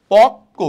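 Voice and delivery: পক্ক very loud, as pok-ko; doubled consonant. Shown as a demonstration. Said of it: "দুইবার উচ্চারণ হবে".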